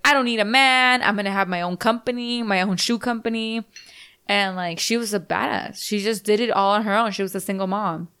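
ordinary delivery

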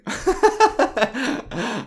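high rueful laugh; self-deprecating laugh. Real, not acted. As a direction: laugh at yourself. Laughing at own emotionality.

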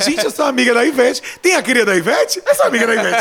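loud, gloating brag; gleeful boast. Real, not acted. Fan bragging to friends about knowing [name], loud and gleeful. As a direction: show off to everyone.

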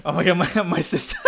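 normal talking voice

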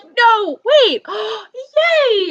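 high, loud gasp of relief and joy; retold delighted realisation; joyful surprise. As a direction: gasp then cheer. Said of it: "Tuesday I was like".

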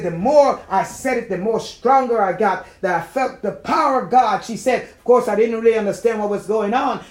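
loud, swelling preacher's testimony; fervent retelling. Retold or imitated. Preacher retelling her feeling God's power, fervent. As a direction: tell her testimony with mounting force.

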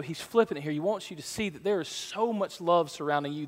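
ordinary talk

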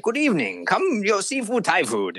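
put-on high, accented come-on from the maitre d'; sing-song invite from a pushy host; hammed-up Thai maitre d' luring tourists in. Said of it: "And he's like".

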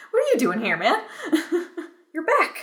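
high, loud, laughing disbelief; hammed-up incredulous greeting; mock astonishment. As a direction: playfully confront someone who shouldn't be here. Mock-confronts the returning animator.

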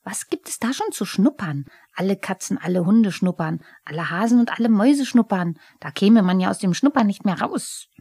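a huffy little goblin scoffing at the cat; Pumuckl the kobold. Acted. Pumuckl spricht, Kobold im Streit.

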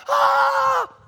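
long high loud whoop; the whole bench erupts; hyped bench cry. Retold or imitated. Whole bench reacting to dunk.

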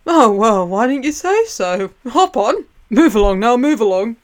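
put-on loud, hurried old boatman ushering them aboard; suddenly all helpful, hustle them on; grumpy ferryman turned eager. Charon shouting at ghosts to make room, eager.

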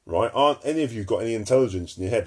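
ordinary talk, nothing marked

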